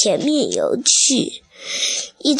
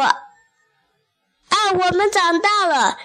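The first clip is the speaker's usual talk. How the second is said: high-pitched proud announcement; acted delight, pitched up; excited tadpoles; 7 semitones higher than usual. A narrator's reading. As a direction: announce it in a raised, storybook voice. Tadpoles announce they have grown up.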